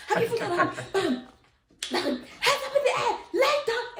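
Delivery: high excited retold cop orders; hammed-up police voice; mock police shout. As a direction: act out the cops yelling.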